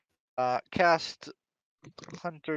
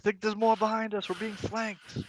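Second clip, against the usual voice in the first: urgent warning; 8 semitones higher than usual. Acted. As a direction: sound the alarm to your group. Character alerts the group.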